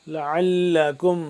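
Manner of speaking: ordinary talk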